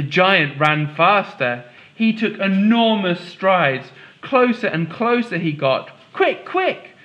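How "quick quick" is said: much higher, breathless urging; urgent high cry of a girl on the run. Acted. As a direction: hurry your sisters along. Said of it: "Molly urged her sisters".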